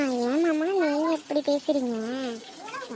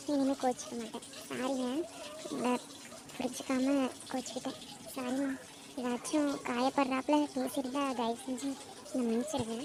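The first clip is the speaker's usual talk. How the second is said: tender apology; 7 dB quieter than usual. Acted.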